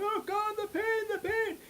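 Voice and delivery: very high, loud cries of agony; a patient moaning on his bed, high and loud; wailing in pain. Retold or imitated. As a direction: keep howling the pain. Patient still crying in pain.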